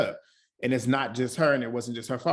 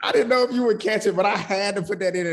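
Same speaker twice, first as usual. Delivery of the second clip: high and louder, still buzzing from laughing; excited; 6 semitones higher, 6 dB louder than usual. Real, not acted. Admitting the edit joke to her co-host, excitedly. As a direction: gush it out, proud of the joke.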